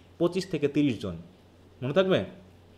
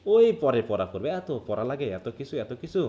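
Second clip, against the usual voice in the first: imitating reluctant students; 7 semitones lower than usual. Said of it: "তারা প্রথমে মনে করে". (অনিচ্ছুক ছাত্রের নিচু গলার নকল)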